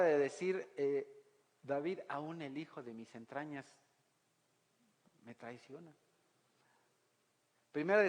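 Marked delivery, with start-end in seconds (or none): 2.1-5.9 soft, hurt whisper of betrayal; quiet, heartbroken father; hushed grief. Acted. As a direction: let it sink to a wounded hush. David's lament, betrayed by son.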